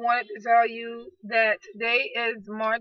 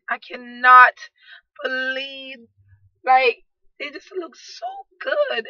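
overjoyed gushing; 2 semitones higher, 6 dB louder, slower than usual. Real, not acted. Vlogger gushing to viewers about her photo shoot, overjoyed. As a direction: gush like you can't believe how good they look.

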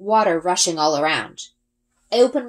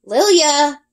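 strained call; 8 semitones higher, 6 dB louder than usual. A narrator's reading. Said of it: "gritting my teeth".

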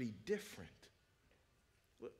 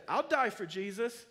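loud, high, fearless declaration; a martyr's eager battle cry, very loud; defiant excitement; 12 semitones higher, 14 dB louder than usual. Retold or imitated. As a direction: shout it like you can't wait to go. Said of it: "who are said".

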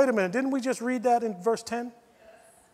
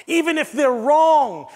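ordinary delivery

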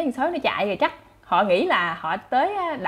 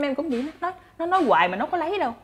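ordinary talk

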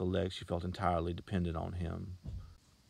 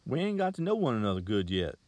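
coaxing; 5 semitones higher, 6 dB louder than usual. A narrator's reading. Salesman coaxing the girl, higher and louder. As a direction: sweet-talk her along. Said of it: "he said coaxingly".

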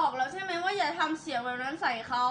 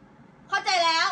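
higher, louder, drawn-out mock reply; slow, raised-pitch example of how not to answer; forbidden tone of voice; 4 semitones higher, 6 dB louder, much slower than usual. Shown as a demonstration. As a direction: demonstrate a bad-toned 'got it'. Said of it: "อย่าให้เป็นเสียง".

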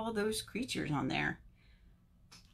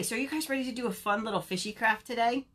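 usual speaking voice

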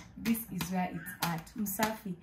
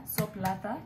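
ordinary talk

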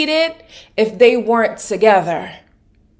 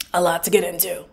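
softer and lower, as an afterthought to oneself; quiet echo; 5 semitones lower, 5 dB quieter, faster than usual. Real, not acted. Host repeating the point to viewers, quietly. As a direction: drop "A lot to get into" down low. Soft echo of the line before.

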